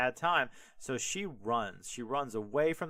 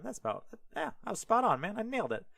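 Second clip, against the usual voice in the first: excited; 9 semitones higher, faster than usual. Real, not acted. Proud she guessed the age right.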